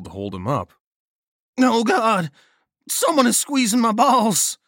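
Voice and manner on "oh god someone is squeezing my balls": high, loud cry of pain; pained yell. A narrator's reading. Balmer crying out as feeling returns in the cold. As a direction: wail as the pain grips him.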